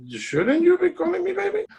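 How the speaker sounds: high, mock-sweet squeaky voice; teasing quote. Retelling a call to a business partner, high teasing voice. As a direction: tease her like a coy partner.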